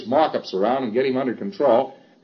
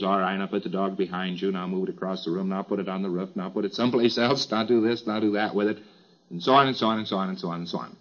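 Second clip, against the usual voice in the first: low, soft run of rote commands; droning auditor; 5 semitones lower, 5 dB quieter, faster than usual. Acted. Auditor ordering the preclear to move the dog, droning. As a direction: reel off the orders in a quiet monotone.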